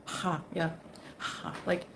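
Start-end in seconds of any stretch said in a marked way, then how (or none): 0.0-0.4 "Ha" pushed out on a breath; breathy Arabic h. Shown as a demonstration. Showing the Arabic sound to viewers, breathy h on "Ha". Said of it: "like a breathy". 1.2-1.5 soft, airy "Ha"; breathy Arabic h. Shown as a demonstration. Trying the Arabic sound once more, soft breathy "Ha". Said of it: "like a breathy".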